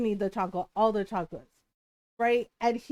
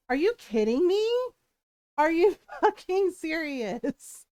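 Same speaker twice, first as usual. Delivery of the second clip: louder, higher, outraged disbelief; incredulous outburst, dragging out the second question; indignant disbelief; 6 semitones higher, 3 dB louder than usual. Retold or imitated. Ticked-off streamer answering a plea to be nice, indignant.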